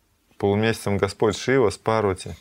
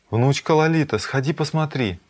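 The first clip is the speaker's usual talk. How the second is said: higher, louder call to a granddaughter; 6 semitones higher, 3 dB louder than usual. Acted. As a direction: call her over to take a look. Calls granddaughter to go look.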